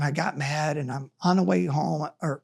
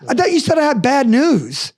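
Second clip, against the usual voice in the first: surprised disbelief; 6 semitones higher, 5 dB louder than usual. Retold or imitated. Disbelief at boss's good news.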